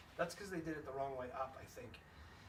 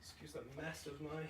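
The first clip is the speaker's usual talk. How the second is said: breathless, gasping for air; panting between words; out of breath; 5 dB quieter than usual. Real, not acted. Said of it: "Excuse me being out of breath".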